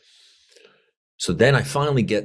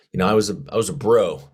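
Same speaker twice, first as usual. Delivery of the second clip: normal talking voice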